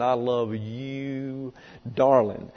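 slow, drawn-out sweet talk; mock lovey-dovey. Shown as a demonstration. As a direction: stretch out LOVE and YOU like a smooth-talking suitor. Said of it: "put all kinds of, uh, emotion behind it".

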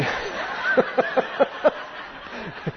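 breathy high-pitched laugh. Real, not acted. Teacher laughing about his wife and the password. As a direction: laugh at the joke. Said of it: "Joe Henry's a barbarian".

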